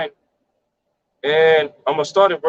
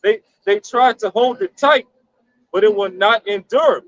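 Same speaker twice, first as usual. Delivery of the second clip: slow, raised-pitch verse reading; scripture reading; 5 semitones higher, slower than usual. Acted. Reading Job in the NLT, slow declaimed reading. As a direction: read it out slowly like a proclamation.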